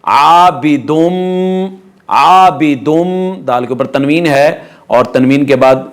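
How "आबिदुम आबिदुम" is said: आबिदुम slowly, long hummed final m; idgham with ghunnah. Shown as a demonstration. Teacher demonstrating the merger, idgham with ghunnah. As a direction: hold the m of आबिदुम nasally. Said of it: "इदगाम माउल गुन्ना".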